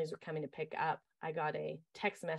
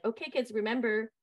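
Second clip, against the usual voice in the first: put-on teacher to kids; 5 semitones higher, 5 dB louder than usual. Camp leader quizzing kids.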